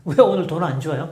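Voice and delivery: ordinary talk, nothing marked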